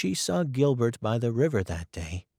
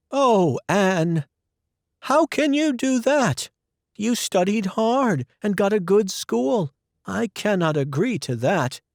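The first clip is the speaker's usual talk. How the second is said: put-on much higher, louder pleading older woman; upset guardian, raised voice; Marilla's protest; 9 semitones higher, 5 dB louder than usual. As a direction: object in dismay.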